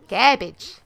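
punchy "Garbage" in a bad mock accent; bad put-on accent. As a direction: hit the word loudly in a clumsy fake accent. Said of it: "That's a really bad accent".

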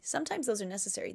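normal talking voice

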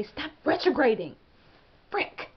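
hammed-up exasperated outburst; mock scolding. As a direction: yell at a planet in mock anger. Scolds Mercury as if present.

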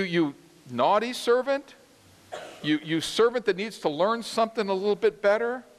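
teasing, rising questions; mock soft alternatives. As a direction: offer gentler names with a wry lift. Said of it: "No, he says".

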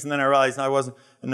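ordinary talk

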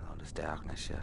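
hushed whispered curse; whispering. Real, not acted. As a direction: whisper it under your breath. Sneaking through dark game level.

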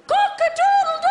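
very high, loud sung rooster crow. Retold or imitated. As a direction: crow like a cheeky little boy. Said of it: "my big brother went".

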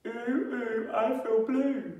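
high, loud sing-song chant. Shown as a demonstration. Phonics rhyme, sung high voice.

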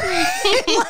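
bright high burst of laughter. Real, not acted. Mom laughing at son.

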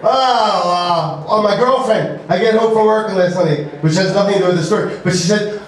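ordinary talk

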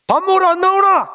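loud, high call through the house; mother hollering for a son shut in his room. Retold or imitated. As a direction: shout for him to come eat. Mother calling son to eat.

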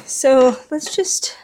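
ordinary delivery

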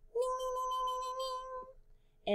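high, quiet repeated "ning"; ding-ding signal. Shown as a demonstration. Teacher signals the right syllable to the class, high ding-ding. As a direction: chime a tiny bell with the voice.

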